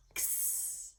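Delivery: X said as a quick voiceless ks; fast whispered ks for X; X sound. Shown as a demonstration. Teacher demonstrating the letter sound, whispered ks. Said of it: "a K and an S sound together really fast".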